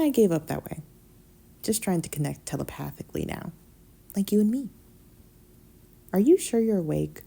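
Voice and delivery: ordinary delivery, nothing marked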